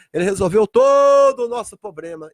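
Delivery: shouted climax on a stretched "todo"; full-force preaching. Real, not acted. Preacher ending a list of solved problems, fervent shout. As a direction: burst out on "todo", then come down.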